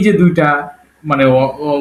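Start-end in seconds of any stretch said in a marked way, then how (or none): none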